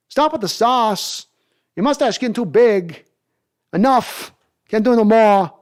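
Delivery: hammed-up celebrity impression in a bad accent; Christopher Walken. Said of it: "I'm doing a horrible accent right now".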